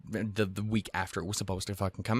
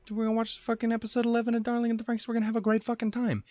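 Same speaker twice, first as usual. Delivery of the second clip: high, excited hype voice; hyped up; 12 semitones higher, faster than usual. Real, not acted. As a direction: pump up the viewers. Hyped intro to episode.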